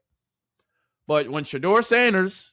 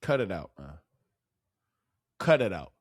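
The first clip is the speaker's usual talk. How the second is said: fed-up mutter; 11 semitones lower, 7 dB quieter than usual. Real, not acted. Exasperated plea to the team.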